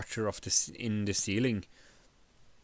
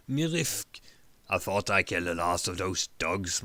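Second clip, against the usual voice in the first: barbarian's line, lightly voiced and a bit gruff; muttered Grignr line; 2 semitones lower than usual. A narrator's reading. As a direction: mutter it like a tired brute who thought he was done. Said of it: "muttered Grignr".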